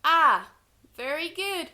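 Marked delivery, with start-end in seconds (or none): 0.0-0.5 loud drill sound. Shown as a demonstration. Teacher to class, loud open Ah to repeat. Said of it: "teacher leads sound drill".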